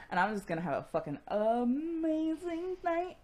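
high, gushing Picard imitation; hammed-up delight, rising into a high pitch; giddy excitement. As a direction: play him thrilled about his big night. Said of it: "He is so fucking excited".

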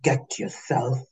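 quiet, calm and cutting. Acted. Leaving poolside, glaring at the pair, quiet calm. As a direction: keep it icy calm despite the anger. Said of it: "your words came out calm".

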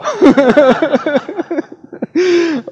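loud high burst of laughter; hearty real laughter; big laugh. Real, not acted. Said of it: "Oh, poor Jimmy".

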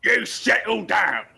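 slow commanding bark at the goblins; bugbear voice. Acted. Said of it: "He's like".

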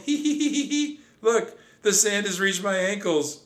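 very high, giggling through the words; a mouse giggling while sinking in sand. Acted. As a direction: giggle like it's a game. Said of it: "I giggled".

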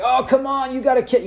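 high-pitched playful complaint, no shouting; mock mad, not shouting. Fan grumbling at his team, high but not shouting. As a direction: fake grumble at a fantasy loss. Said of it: "I'm talking mad, not shouting".

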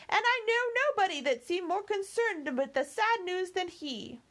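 put-on high, chattering old housekeeper voice; fussy old servant. Housekeeper nagging her young mistress to come inside, high fussy voice. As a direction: fuss and scold like a doting maid. Theresa urges Emily to eat.

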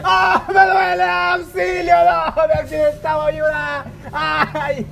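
hammed-up crash victim. Injured man performing pain for passersby, hammed-up wailing. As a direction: overplay the injured man for laughs. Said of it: "lo que usualmente la gente hace cuando se accidenta".

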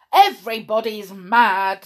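exasperated. A narrator's reading. Perry protesting about being bandaged.

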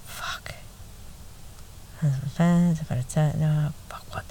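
whispered curse as the mind blanks; panicked whisper. Retold or imitated. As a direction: hiss it in silent panic. Panicked mind goes blank on stage.

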